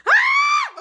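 loud, very high squeal of excitement; piercingly high happy shriek; excited scream. Real, not acted. Reviewer thrilled by dill pickle popcorn, excited scream. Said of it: "I'm getting so excited".

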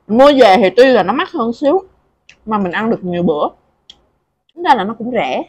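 eating between words; talking while chewing. Real, not acted. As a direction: mouth partly full of food. Seen 3: Closed, moving lips between spoonfuls while talking; eating throughout.

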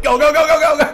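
high, loud frantic go go; imitating his own panic at the shark; mock panicked yelling. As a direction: retell the panic as a yell. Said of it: "I'm like".

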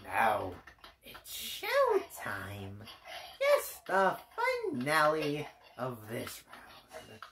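dark lord. Pretend. Villain announcing the finale.

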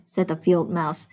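usual speaking voice

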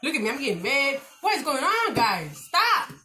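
high, loud and hyped up from the hot sauce; hot sauce hype. Real, not acted. Vlogger to viewers, all fired up from hot sauce: high, loud excitement. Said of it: "This hot sauce got me hyped".

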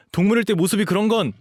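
high, loud, defensive objection; indignant protest. Acted. Dog boy defending his puppy looks, indignant. Indignant about being taken for young.

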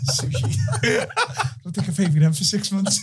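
high, laughing confession. Real, not acted. Telling a mate about unpaid rent, laughing. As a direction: giggle through the admission.

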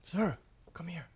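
hushed whisper to a teammate. Real, not acted. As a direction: whisper it so the ghost won't hear. Spooky find, hushed call.

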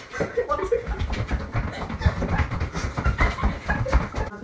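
squealing laugh; high laughing fit. Real, not acted.

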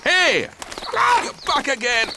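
flustered shouting at a bird; shouting at the crow. Acted. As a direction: yell to shake it off. Gardener fights crow on his head.

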